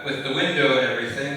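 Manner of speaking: usual speaking voice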